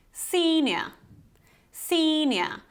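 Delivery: "senior" with a long E, "SEE-nyuh"; proper pronunciation. Shown as a demonstration. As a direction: hold the long "ee" in "senior", British ending. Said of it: "you have this long E".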